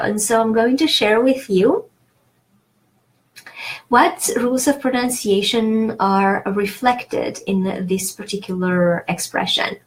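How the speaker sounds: ordinary delivery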